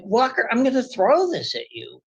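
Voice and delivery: reenacting a warning to the neighbor; mock warning. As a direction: retell the threat with a lifted pitch. Retelling threat to neighbor.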